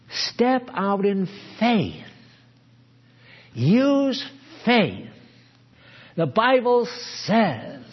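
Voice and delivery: high, drawn-out urging with long pauses; slow, high-pitched goading; exhorting tempter. Acted. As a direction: push each phrase high and slow, like a tempter's challenge. Mocking tempter urging faith, exaggerated.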